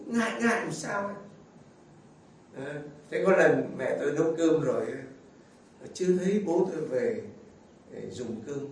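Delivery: ordinary delivery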